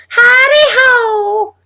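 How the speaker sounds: loud, very high, stretched-out cry; high character impression. Shown as a demonstration. Streamer trying out a voice and asking how close, loud high character greeting. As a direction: screech it slowly in a cartoon voice. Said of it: "When I finally tried the Crypt Keeper, that voice was so".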